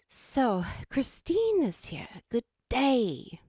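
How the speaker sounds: high, drawn-out Australian greeting; exaggerated Australian host welcome; Mama Meow's Australian voice. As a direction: hail them in a hammed-up Aussie accent. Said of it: "Now I'm gonna do Mama Meow".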